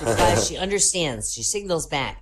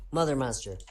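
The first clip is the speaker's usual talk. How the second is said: ordinary talk, nothing marked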